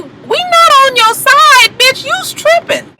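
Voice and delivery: very high, drawn-out retort; high-pitched incredulous clapback; mock correction. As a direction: set her straight in a high, stretched-out voice. Talking back to Mimi.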